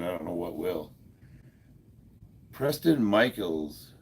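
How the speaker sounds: usual speaking voice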